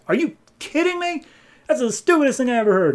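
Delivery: very high-pitched incredulous outburst; acted outrage. Aquaman hears Vulko's reason for the war, acted outrage. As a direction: explode in hammed-up disbelief.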